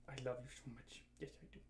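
very soft, low doting murmur; tender. Real, not acted. Owner doting on a sleepy dog, tender and hushed. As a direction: dote softly on a sleepy pet.

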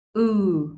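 Ừ held long with rounded lips pushed forward; long clear u. Shown as a demonstration. Teacher modelling a long rounded Ừ for students. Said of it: "kéo dài âm một chút xíu".

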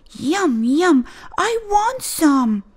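put-on excited kid. Clark begging for ice cream, excited. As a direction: want it so much you can taste it.

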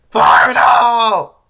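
high, full-force warning yell. A narrator's reading. Flippy warns the crew of the blast, yelling. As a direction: yell it out at the top of your voice. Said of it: "Flippy yelled".

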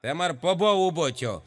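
ordinary talk, nothing marked

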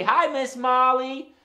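high, loud drawn-out greeting; singsong holler to a puppet friend; calling out. Real, not acted. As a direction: lead the crowd in a big slow hello. Leads children calling out a greeting.

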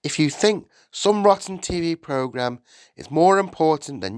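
losing patience. A narrator's reading. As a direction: scold him with mounting impatience. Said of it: "began to lose patience".

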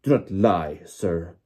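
loud, stern rebuke; shouted. A narrator's reading. Official accusing the boy of lying, shouting. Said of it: "shouted Mister Crouch".

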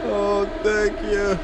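a grateful gamer sighing as the boss falls; drawn-out relief. Real, not acted. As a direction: stretch it out in relief. Boss finally dies.

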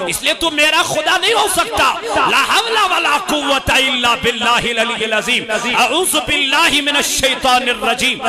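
loud, high shouted storytelling; fiery sermon climax; preaching at full force. Acted. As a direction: thunder out the saint's victory over the devil.

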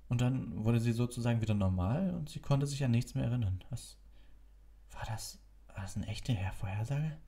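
lightly voiced worried boy; young Harry voice. A narrator's reading.

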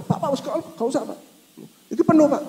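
high, quick imitated conductor; bus conductor. Preacher imitating a bus conductor turning him away, high. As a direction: mimic the conductor waving him off.

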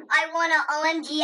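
ordinary delivery

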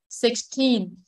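put-on Sixteen with TEEN stressed; six-TEEN, second syllable prominent. Shown as a demonstration. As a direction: put the weight on the end of Sixteen. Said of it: "nhấn trọng âm số hai".

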